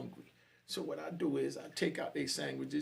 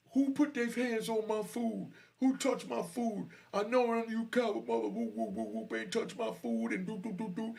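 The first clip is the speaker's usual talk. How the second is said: retold angry yell; 10 semitones higher than usual. Dude yelling about his touched food, retold angry yell. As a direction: imitate an angry man yelling to the whole room. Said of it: "he yells out".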